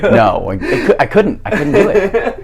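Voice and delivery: high, loud, laughing insistence; laughing protest. Real, not acted. Guest explaining he couldn't refuse church, real laughing protest. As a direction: protest helplessly through laughter.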